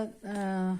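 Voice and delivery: अ said short and soft from the chest; a small quiet अ; short light a. Shown as a demonstration. Teacher showing the short अ to students, softly. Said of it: "गले से नीचे chest तक".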